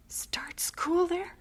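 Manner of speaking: slow, breathless whisper of disbelief; a stunned, slow whisper; dismayed whisper. Acted. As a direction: barely get the words out. Mother dismayed at losing daughter.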